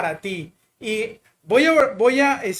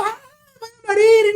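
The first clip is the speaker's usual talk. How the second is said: high, loud, crying shriek of panic; hysterical screaming; 13 semitones higher, 8 dB louder than usual. Retold or imitated. Panicked girls screaming at the crew, high loud cries. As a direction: scream it as if about to die. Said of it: "siguieron gritando".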